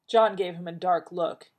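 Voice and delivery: ordinary delivery, nothing marked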